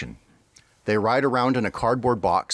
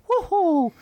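high cartoon "Woohoo" cheer; Mario voice. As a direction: whoop it like Mario mid-jump. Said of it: "be back to, like".

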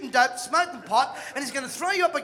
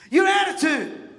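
loud, slow, higher punch on YOUR; shouted emphasis; 7 semitones higher, 6 dB louder than usual. Acted. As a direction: bark it out to wake the room. Preacher punches key phrase.

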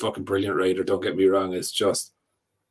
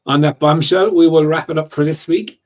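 sign-off; 7 semitones higher, 8 dB louder than usual. Real, not acted. Signing off the show loudly.

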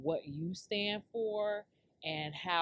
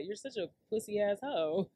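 ordinary talk

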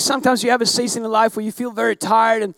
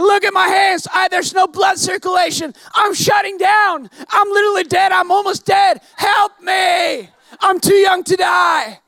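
panicked pleading; 5 semitones higher than usual. Retold or imitated. Pleading with the nurses, high and panicked. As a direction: beg for help as if dying.

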